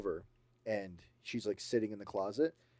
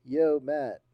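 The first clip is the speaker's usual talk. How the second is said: calling out; 5 semitones higher, 9 dB louder than usual. Acted. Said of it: "Amanda's like".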